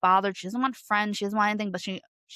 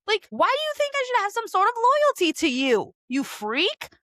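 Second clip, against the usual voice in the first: high, indignant quoted rant, pitched way up; incredulous voiced comeback, much higher; outraged retort; 10 semitones higher than usual. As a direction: fire it back at him in disbelief. Danny's angry retort retold.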